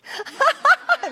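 high loud burst of laughter. Real, not acted. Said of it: "[name] said".

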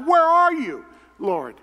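high, loud plea to heaven; desperate cry. Retold or imitated. Pastor crying out to the Lord, desperate and loud. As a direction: call out to a silent God.

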